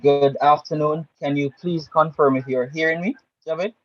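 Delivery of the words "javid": ordinary talk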